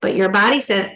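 ordinary talk, nothing marked

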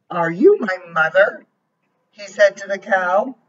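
lively storybook voice, each line set apart; reading to a child. A narrator's reading. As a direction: read it like a bedtime book for a little one. Reads picture book aloud.

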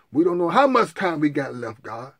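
loud pleading prayer; loud, repeated demanding plea; fervent prayer. Acted. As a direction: storm heaven with the questions.

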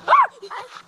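sudden high yelp; startled yelp. Real, not acted. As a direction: jump and yelp. Ant drops.

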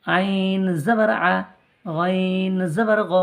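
slow, drawn-out chant of the syllable; recitation drill. Shown as a demonstration. Teacher chants letter drills slowly for the class. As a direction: chant it slowly for students to copy. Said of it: "এটা অকার".

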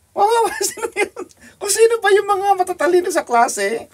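high-pitched laugh breaking into the words; laughing disbelief. Real, not acted. Preacher laughing at smart people's poor choices, high and laughing.

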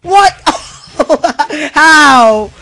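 startled, high, loud disbelief; shocked laughter. Real, not acted. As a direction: caught off guard, laughing. Shocked at sudden death in game.

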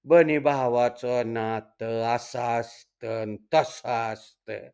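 low, slow mock preachy recital; mimicking an old woman's drawn-out moralising; droning old woman's sermon. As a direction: drone it out like a tiresome scripture reading. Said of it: "त्या थिरडीनं लावलं पुराण".

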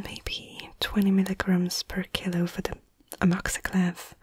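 ordinary talk, nothing marked

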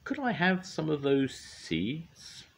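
high, slow little bird; high thrush voice. Acted. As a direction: ask slowly like a small bird.

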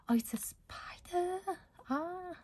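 high, breathless startled whisper; startled by a spider. Real, not acted. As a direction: gasp at the spider, half scared. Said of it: "I'm not going to be afraid".

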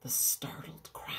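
soft, low whispered narration; storyteller going quiet for suspense; hushed whisper. A narrator's reading. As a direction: draw listeners in with a whisper.

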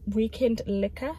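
usual speaking voice

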